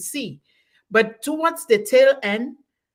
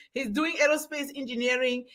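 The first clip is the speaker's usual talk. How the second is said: ordinary talk, nothing marked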